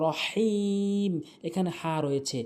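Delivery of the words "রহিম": "রহিম" with throaty h and long held "i"; correct pronunciation. Shown as a demonstration. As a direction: hold out the "i" of "রহিম". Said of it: "হলকের মাঝখান থেকে".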